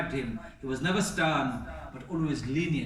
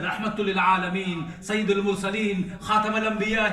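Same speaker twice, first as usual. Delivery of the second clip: proclaiming titles; 5 semitones higher, 7 dB louder than usual. Acted. Grand titles he could have claimed.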